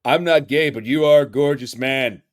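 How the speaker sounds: loud, emphatic newscaster impression; big hammed-up anchorman delivery; Ron Burgundy. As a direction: boom it out like a pompous anchorman. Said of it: "Ron Burgundy there".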